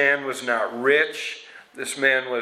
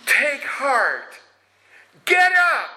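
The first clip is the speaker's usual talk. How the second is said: very high, rising urgent call; 13 semitones higher, 4 dB louder, slower than usual. Retold or imitated. Crowd calling the blind beggar to come, excited high call. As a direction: urge him up with excitement.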